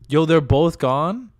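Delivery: stretched, loud disbelieving question; loud surprise. Real, not acted. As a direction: call out surprised they both left. Friends left the call suddenly.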